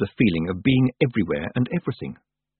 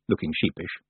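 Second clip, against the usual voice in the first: usual speaking voice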